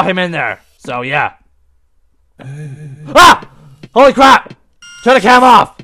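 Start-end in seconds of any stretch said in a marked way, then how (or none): 2.4-3.5 sudden short shriek; startled scream. Real, not acted. Gamer caught off guard, startled scream. Jump scare in horror game. 3.9-5.8 high, loud startled outburst; panicked shout. Real, not acted. Gamer rushing to shut the camera, panicked shout. Panic right after a scare.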